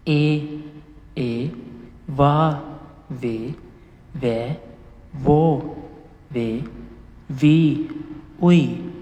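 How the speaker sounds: each syllable from ए to उई said crisply and separately; syllable drill. Shown as a demonstration. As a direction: sound out the vowel row one by one. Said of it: "अब इसी तरह".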